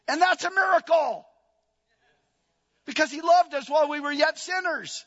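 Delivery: high, ringing preaching that builds to a climax; full-force preaching. Real, not acted. Preacher proclaiming God's miracle to congregation, building high and loud. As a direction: proclaim it, pitch climbing with each phrase.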